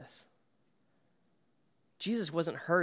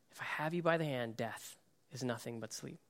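softer, quicker, near a hush; soft repeat; 4 semitones lower, 10 dB quieter than usual. Acted. Jesus speaking over the dead girl, hushed and tender. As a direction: repeat the promise gently, almost to yourself.

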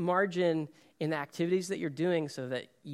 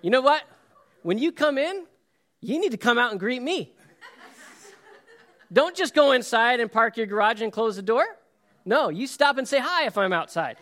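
mock demanding neighbor; 6 semitones higher, 5 dB louder than usual. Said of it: "And I was like".

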